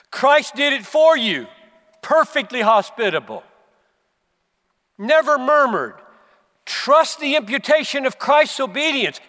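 loud, high, impassioned pulpit preaching; preaching at full force. Acted. Pastor urging his congregation toward the commands, preaching at full force. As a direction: pound the point home to the congregation. Preacher mocking half-gospel sermon.

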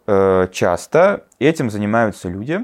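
normal talking voice

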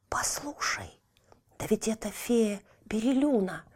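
hushed excited whisper. Acted. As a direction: whisper it into her ear. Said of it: "шепнул Тильтиль на ухо сестре".

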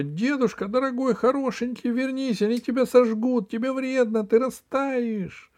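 put-on high, girlish pleading; frightened cry. Снегурочка fears grandfather will melt, high urgent wail. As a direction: beg him desperately to turn back. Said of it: "закричала Снегурочка".

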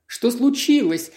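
words tripping over each other in fear; frightened babble. A narrator's reading. Diana, roused in terror, babbling to the men at her door. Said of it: "пролепетала она заплетающимся языком".

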